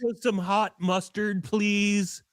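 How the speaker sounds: timid customer. Retold or imitated. Co-host playing the nervous customer asking for extra, high.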